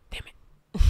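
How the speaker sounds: frustrated whisper under breath. Real, not acted. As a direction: hiss it to yourself. Muttered frustration, unvoiced.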